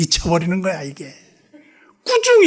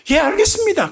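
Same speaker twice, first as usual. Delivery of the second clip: high, crisp obedient reply; obedient yes; 13 semitones higher than usual. Retold or imitated. Gyeongsang member accepting the pastor's order, high crisp reply.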